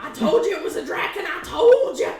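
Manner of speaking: high, loud, laughing I-told-you-so; gleeful vindicated shout; triumphant outburst. Real, not acted. Excited player crows about being right.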